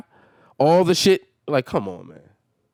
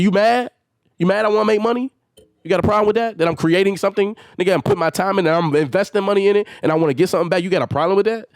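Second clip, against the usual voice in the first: defiant challenge; 7 semitones higher, faster than usual. Real, not acted. Challenging his brother about wanting money, raised and defiant. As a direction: dare him to object.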